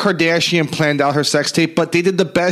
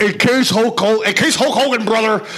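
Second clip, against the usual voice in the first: Hulk Hogan voice; 8 semitones higher than usual. Retold or imitated. Hulk Hogan impression, "brother.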